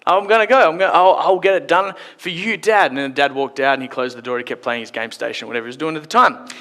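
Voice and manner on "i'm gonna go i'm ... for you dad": higher, louder over-keen promise; eagerly promising son. Acted. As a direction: promise Dad with hammed-up eagerness. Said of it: "And the son said".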